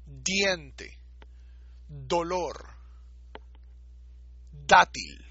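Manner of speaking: Diente, dolor, dátil with firm tongue-on-teeth d; hard Spanish d. Shown as a demonstration. Teacher shows the hard Dalet with Dagesh. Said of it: "una D fuerte española".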